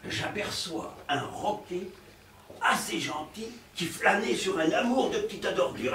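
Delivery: ordinary talk, nothing marked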